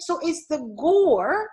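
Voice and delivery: ordinary talk, nothing marked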